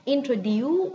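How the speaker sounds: wrong-way "Introduce", lifted at the end; commonly taught mispronunciation. Shown as a demonstration. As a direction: mimic the wrong "Introduce" with a "dew" ending. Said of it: "we always teach that way".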